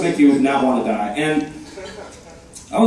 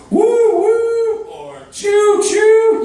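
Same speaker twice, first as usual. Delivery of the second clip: high train whistle woo woo and choo choo; much slower than usual. Shown as a demonstration. Mimicking a train's sound for the crowd, high whistle. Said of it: "we'd be like".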